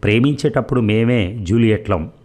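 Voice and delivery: ordinary delivery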